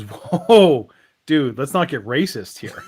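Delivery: acted drawn-out, loud whoa, then easing down; taken aback. Bartender objecting to the shark's remark, taken aback. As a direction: pull the shark up short, mock alarm.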